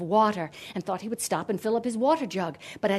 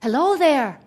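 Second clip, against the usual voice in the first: higher, louder friendly hail; hearty greeting; 5 semitones higher, 6 dB louder than usual. A narrator's reading. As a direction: call out a cheery hello. Hero calls out to young woman.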